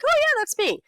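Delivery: very high-pitched, self-satisfied mock voice; mock narcissist. Wife mocking how she seems showing husband videos, mock narcissist. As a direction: play a self-absorbed person lightly mocked. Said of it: "I seem like a narcissist being like".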